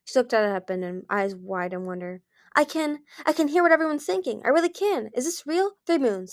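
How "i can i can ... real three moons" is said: high, breathlessly thrilled discovery; amazed excitement. A narrator's reading. Mind Reader suddenly hearing everyone's thoughts, amazed. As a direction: marvel with rising excitement, pitched well up.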